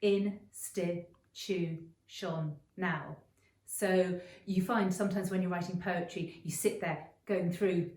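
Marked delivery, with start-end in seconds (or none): 0.0-3.2 "in-sti-tu-shon-now" in five very slow beats; syllable by syllable. Shown as a demonstration. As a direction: count "in-sti-tu-shon-now" out on your fingers. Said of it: "institutional has five syllables".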